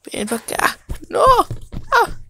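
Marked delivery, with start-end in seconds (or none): 1.2-2.2 high crying yelp; short high cry; pained cry. Acted. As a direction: yelp out in pain. Creature hurt.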